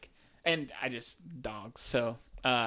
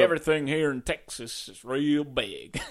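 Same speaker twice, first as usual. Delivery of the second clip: put-on Texas accent; 3 dB louder than usual. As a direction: boast like a cartoon Texan. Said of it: "Can you do a Texas accent?".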